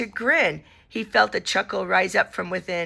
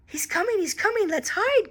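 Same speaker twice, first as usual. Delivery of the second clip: higher, hushed, urgent whisper-cry; frightened cry; 11 semitones higher than usual. A narrator's reading. Eddie warns Freddy of the bear, hushed fright. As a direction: hurry to hide. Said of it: "cried Eddie".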